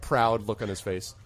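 normal talking voice

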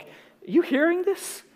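high, slow, insistent question; slow high-pitched nudge; retold insistent question. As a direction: stretch it out high in mock disbelief. Pounding heart, voice insists.

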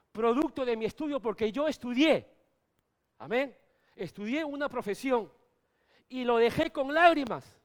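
loud, fervent testimony from the pulpit; impassioned preaching. Acted. Pastor tells the congregation his sacrifice, fervent preaching. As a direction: preach it with tears in the voice.

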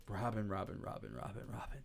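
low, fading self-talk; muttering to himself. Retold or imitated. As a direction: lose yourself chanting the name.

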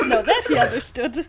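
laughing through the words. Real, not acted. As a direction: laugh while you tease. Laughing while teasing.

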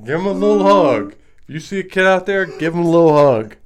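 loud and playful; loud joking slogan. Pretend. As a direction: call it out like a slogan.

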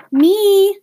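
high, loud shout; excited yell. A narrator's reading. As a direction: yell it out eagerly. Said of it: "they all yelled".

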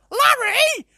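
very high, loud squawk; piercing, shrieked bird call; parrot with a tic. Acted. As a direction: screech it out like a crazed parrot. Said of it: "this crazy tick where it's just going".

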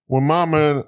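failed Australian, landing in Georgia; Georgia accent. Acted. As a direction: try Australian but sound Southern. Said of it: "It's Georgia".

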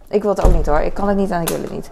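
loud, fast, mock-defiant refusal; emphatic no. Real, not acted. Vlogger loudly refusing politics, fast and defiant.

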